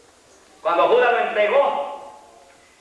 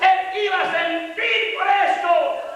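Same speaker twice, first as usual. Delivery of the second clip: high, driving pulpit shout; impassioned high-pitched sermon cry; full-force preaching; 12 semitones higher than usual. Real, not acted. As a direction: drive each phrase home at a shout.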